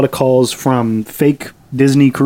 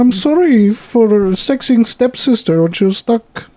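put-on childish voice; 8 semitones higher than usual. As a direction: whimper it like a small child. Mock childish apology.

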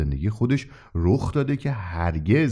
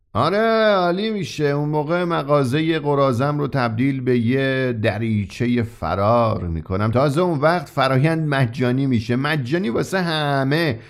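sudden enthusiasm; 4 semitones higher than usual. Acted. Shopkeeper suddenly excited about his escape hatch. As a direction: burst out with eager joy, some words slowed. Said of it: "با فریادی حاکی از شور و شوقی ناگهانی".

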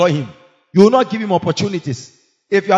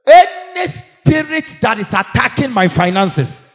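fervent prayer; 6 semitones higher, 4 dB louder than usual. Real, not acted. Leading prayer.

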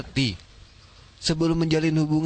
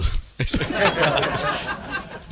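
laughs mid-take, cracking up; real giggle breaking the scene; breaking into laughter. Real, not acted. Breaks up during retake.

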